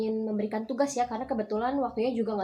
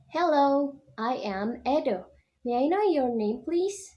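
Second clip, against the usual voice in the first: lively dialogue reading as Edo; 4 semitones higher, slower than usual. Shown as a demonstration. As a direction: read Edo's lines with clear, lively intonation. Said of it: "dengan intonasi yang tepat ya. Dan juga keras nih suaranya".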